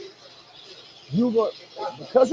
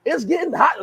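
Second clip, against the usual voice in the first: teasing; 8 semitones higher, 3 dB louder than usual. Retold or imitated.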